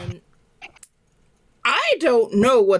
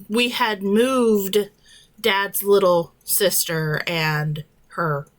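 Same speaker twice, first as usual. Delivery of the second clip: normal talking voice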